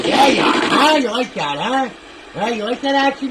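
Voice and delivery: high, loud jeering; taunting shout. Real, not acted. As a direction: rub the kill in his face. Taunting enemy after kill.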